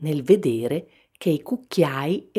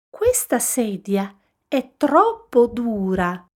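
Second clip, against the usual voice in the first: higher-pitched grumble from Goldilocks; complaining; 8 semitones higher than usual. A narrator's reading. Goldilocks trying papa bear's chair, complaining. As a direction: whine in a high voice about discomfort. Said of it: "si lamentò".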